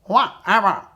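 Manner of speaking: high, loud quacking duck voice. Shown as a demonstration. Coach shows the password as a high, loud duck. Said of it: "like a duck".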